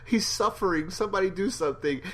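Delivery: high, fast mock plea; pleading Andrea. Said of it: "Andrea says".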